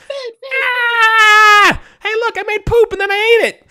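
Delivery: put-on very high, loud screaming child voice; shrieking toddler. GM playing a baby boasting loudly to the villager. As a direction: shriek it like a proud little kid. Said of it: "a baby's like".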